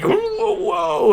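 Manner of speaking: very high, drawn-out clownish whoop; crazy foreigner clown. Acted. Performer hamming it up for a commercial, crazy clown. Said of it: "act like this big, crazy foreigner clown".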